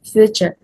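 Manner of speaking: future with a ch, FYOO-cher; correct pronunciation. Shown as a demonstration. Teacher repeating the correct future, correctly pronounced. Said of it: "The correct pronunciation is".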